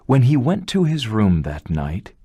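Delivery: ordinary delivery, nothing marked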